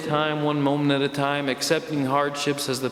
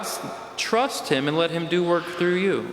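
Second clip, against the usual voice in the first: usual speaking voice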